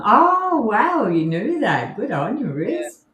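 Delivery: high-pitched, swooping wow; impressed delight. Real, not acted. Co-host praises Riz for knowing the quote, delighted. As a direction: gush with surprised admiration.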